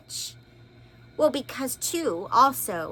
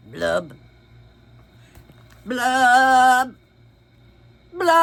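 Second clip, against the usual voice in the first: rising sung blub blub blub; mock fish blubs; 3 semitones higher, 12 dB louder, much slower than usual. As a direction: bubble it out like a fish. Fish bubbling sounds.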